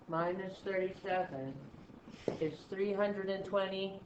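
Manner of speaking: slow and level, with a gap mid-sentence; slow, uninflected talk. Shown as a demonstration. Teacher finishing the quadrant four answer, slow and uninflected. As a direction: measure it out evenly, without inflection. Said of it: "I'm going way too slow".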